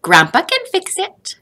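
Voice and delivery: put-on a boy insisting grandpa can help; child Joseph. A narrator's reading. Joseph answers his mother hopefully.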